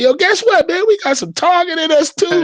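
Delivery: very high and loud hype; telling the family they have Tongan roots; thrilled announcement. Retold or imitated. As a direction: shout the big news to the family. Texting family the news.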